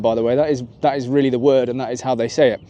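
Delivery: ordinary talk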